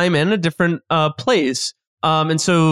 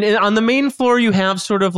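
ordinary talk

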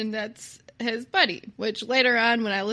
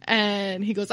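ordinary talk

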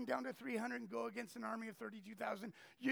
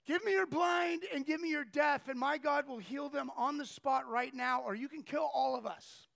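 bold declaration; 3 semitones higher, 7 dB louder than usual. Retold or imitated. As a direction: throw down the gauntlet to the chief. Heidi challenges chief with spears.

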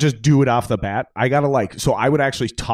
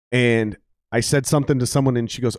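ordinary delivery

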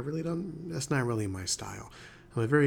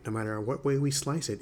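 ordinary talk, nothing marked

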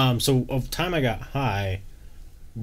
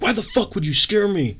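high-pitched retold angry outburst; mad; 9 semitones higher than usual. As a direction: act out being scared and mad at mom. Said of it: "I'm, like, so mad".

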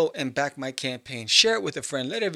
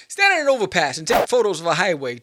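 firmly louder; loud, firm fix of the advice; louder correction; 3 semitones higher, 8 dB louder than usual. Real, not acted. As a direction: say it clearly and emphatically. Emphatic correction.

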